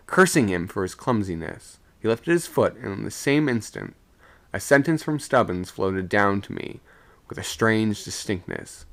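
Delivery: plain reading voice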